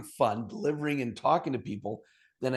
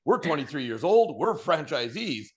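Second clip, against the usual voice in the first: shrieking high then loud and proud; giddy triumph; 5 semitones higher, 9 dB louder, slower than usual. Retold or imitated. Young couple who just bought the stores, crowing with glee. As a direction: cheer like they've got it made. New franchisees celebrating.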